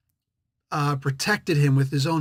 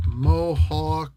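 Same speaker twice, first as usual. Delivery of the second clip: normal talking voice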